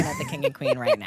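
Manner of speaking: high, laughing proud boast. Real, not acted. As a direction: announce it through laughter. Triumphant announcement with laughter.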